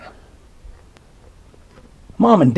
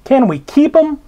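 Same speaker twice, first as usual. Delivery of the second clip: put-on pleading child; 11 semitones higher, 3 dB louder than usual. A narrator's reading. Child begging to keep him.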